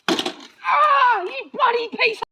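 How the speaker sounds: high, loud furious outburst; frustrated outburst. Acted. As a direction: lose it at the mower. Mower won't start, rage.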